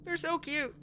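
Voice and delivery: high, loud gushing over the couple; fangirling over sweet characters. Real, not acted. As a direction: squeal with delight at them. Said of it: "I love them".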